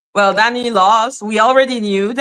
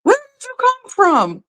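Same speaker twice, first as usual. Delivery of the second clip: much higher, squeaky shocked question; shocked disbelief; 12 semitones higher than usual. Retold or imitated. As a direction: act stunned at who showed up. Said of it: "I was like".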